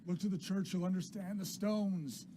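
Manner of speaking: high, loud, ominous cries, slowing at the end; agitated old William. Acted. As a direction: call out a raving warning. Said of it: "He says".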